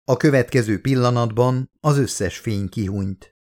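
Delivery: usual speaking voice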